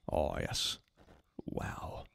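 soft breathy whisper of awe; awed whisper. Real, not acted. Streamer marvels at muscled creature, whispering. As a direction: hush it out in wonder.

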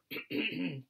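loud theatrical throat clear. Acted. Frederick readying his poem, theatrical throat clear. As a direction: clear the throat before a performance. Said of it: "Frederick cleared his throat".

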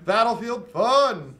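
high, loud, stretched-out announcement; hyped title shout. Acted. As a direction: belt it out like a big game title. Repeats pun as a hyped title.

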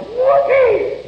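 high mock whoop; hollered high like a tipsy reveller; hollered whoopee. Drunk woman showing off in the hall, high mock whoop.

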